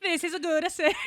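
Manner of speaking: high-pitched bad Italian accent; laughing, hammed-up fake Italian; mock Italian accent. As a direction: do a silly Italian accent, cracking up. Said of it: "what accent was that".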